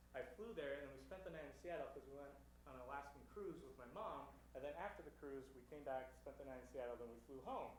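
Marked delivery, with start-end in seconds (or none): none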